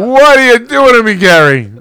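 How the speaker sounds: high, loud whiny wail of What are you doing to me; imitating friend's whine. Retelling a friend's squeaky video plea, high loud whine. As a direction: wail it at Gary like a wounded friend. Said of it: "Remember when he did that".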